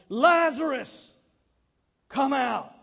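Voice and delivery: Lazarus called out high and loud, the first word drawn out slow. Acted. Jesus calling dead Lazarus out of the tomb, loud ringing call. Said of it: "Jesus called in a loud voice".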